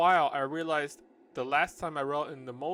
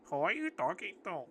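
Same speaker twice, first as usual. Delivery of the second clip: put-on high character voice in an Indian accent; 5 semitones higher than usual. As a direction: rehearse her Indian-accented question. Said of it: "it's gonna be your voice".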